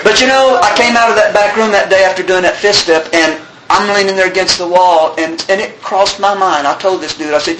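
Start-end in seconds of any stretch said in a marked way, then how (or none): none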